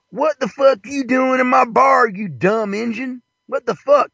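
high, strained Wild West barman; high-pitched hammed-up outrage; angry bartender. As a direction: challenge the stranger in a high, put-on voice. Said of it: "and the bartender, this is back in the Wild West, goes".

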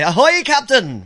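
loud, slow hearty ahoy; cheery hail. Retold or imitated. Arriving by bicycle, hailing the captain loudly. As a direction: call out the greeting from the driveway. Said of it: "I get up there and I say".

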